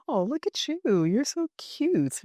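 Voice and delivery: high, drawn-out doting coo; affectionate cooing. Acted. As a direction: coo as if at someone adorable. Cooing over mom's happiness.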